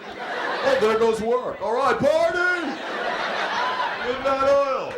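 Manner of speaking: increasingly giddy, high Californian shrugging off the quake; mock carefree Californian. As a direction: play the unbothered local cheering as his life collapses. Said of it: "It's like".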